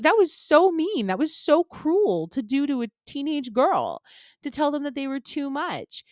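high, heated rant at the parent; angry retort. Retold or imitated. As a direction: tell off the mean parent, slowing on the hurt. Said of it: "and I'm like".

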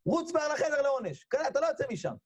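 high-pitched scolding order; angry father. Acted. Father punishing his son, high angry scolding. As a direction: send him off in an acted high-voiced fury. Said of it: "מה זה כועס האבא".

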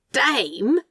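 high, rising query; incredulous echo. Acted. Demon hearing the Roomba's title, incredulous. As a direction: repeat it in disbelief. Demon repeats the name in disbelief.

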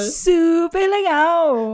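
slow, drawn-out sarcastic praise; ironic praise. Pretend. Host joining the ironic praise game, sarcastic. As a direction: drag the words out in mock delight. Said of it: "eu tô mudando a minha entonação".

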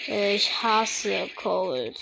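slow, deep put-on voice; disguised deep voice. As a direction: drag it out in a fake deep voice. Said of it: "meine Stimme verstellt".

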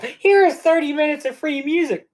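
high-pitched imitation of an app ad; mock automated announcement. Mimicking the ad that plays.

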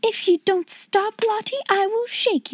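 fussed, high-voiced warning; scolding threat. A narrator's reading. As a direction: threaten the child in high-pitched helpless frustration. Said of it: "then in quite another tone".

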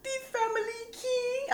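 high, slow, puzzled question; baffled by the caller; confused. Retold or imitated. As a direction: kid answering the phone, high and confused. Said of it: "I was like".

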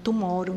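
low, soft "tomorrow" with tapped r; Filipino-accented tomorrow. Shown as a demonstration. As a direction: repeat "tomorrow" quietly, Filipino style, "tu-mó-ro". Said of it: "eles falam tomorrow".

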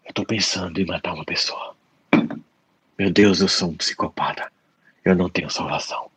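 low, hushed, acted guilt; self-condemning dread. Therapist voices someone punishing themselves, low guilty confession. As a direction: confess it to yourself, softly and heavily.

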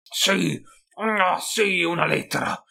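esclamazione acuta e più forte; exclaiming. A narrator's reading. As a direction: entusiasmo improvviso di skaven. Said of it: "esclamò Vilbrod Null". (esclamazione eccitata, acuta)

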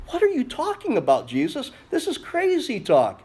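high-pitched, disbelieving objection; incredulous protest. Acted. Peter objects to Jesus's talk of crucifixion, incredulous protest. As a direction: jump up high in acted disbelief.

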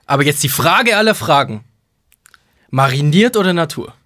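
imitating an over-eager butcher calling out; the question of all questions, hammed up; retold shout from the butcher. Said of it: "Der hat das so durch diesen Edeka geschrien".

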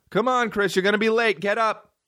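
high-pitched morning wake-up call; imitated nagging mom. Mom rousing him every morning, high nagging call. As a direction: mimic a mom calling her kid up.

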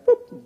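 high little boop; one high beep sound; mimicked beep. Pastor mimics pressing the TV button, high boop.

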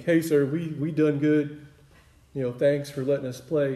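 lightly voiced yokel talking up to a superior; mock yokel. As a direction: play a mild country bumpkin addressing the boss. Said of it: "doesn't sound like a yokel".

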